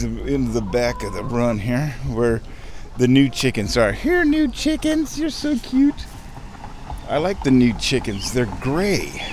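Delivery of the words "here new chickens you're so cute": high cooing pet voice. Real, not acted. Farmer calls the new gray chickens in high cooing baby talk. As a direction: coo sweetly at the new chicks.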